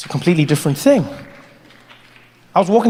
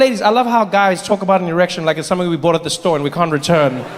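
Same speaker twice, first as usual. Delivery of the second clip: usual speaking voice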